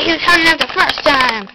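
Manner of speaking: fast, high rush of panic. Pretend. Toy panicked explanation.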